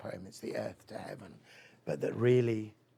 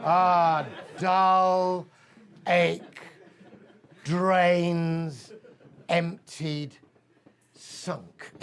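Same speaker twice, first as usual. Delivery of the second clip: loud, higher, hammed-up teenage groan at dull words; mocking groan; 5 semitones higher, 12 dB louder, much slower than usual. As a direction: drag out each dreary word in mock misery. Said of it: "I'm like".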